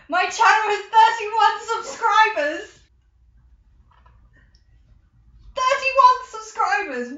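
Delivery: elated exclamation; thrilled announcement. Real, not acted. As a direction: announce it like big news. Excited about subscribers.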